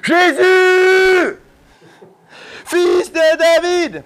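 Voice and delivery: high, loud, drawn-out call to Jesus; crying out. Acted. Blind beggar cries out to Jesus, loud and drawn-out. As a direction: call out from the roadside, slow and loud. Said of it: "il s'écria".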